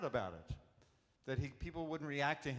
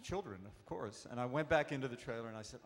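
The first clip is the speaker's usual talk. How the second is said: normal talking voice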